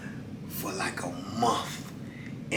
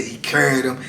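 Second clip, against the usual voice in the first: usual speaking voice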